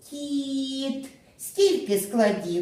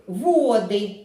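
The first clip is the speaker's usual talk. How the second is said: «Води» as VO-dy, many waters; stress on first syllable. Shown as a demonstration. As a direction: hit «во» in «Води». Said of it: "це коли річка". (наголос на першому складі: «Води»)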